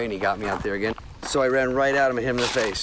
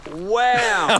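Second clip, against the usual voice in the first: loud high Wham shout; hammed-up impact yell; mock punch sound; 10 semitones higher, 6 dB louder than usual. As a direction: punch the word like a comic-book hit.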